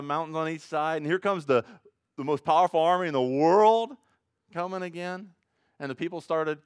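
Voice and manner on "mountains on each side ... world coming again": louder, higher storytelling build; rising sermon drama; dramatic preaching. Real, not acted. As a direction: make the army sound huge. Dramatic retelling of Exodus.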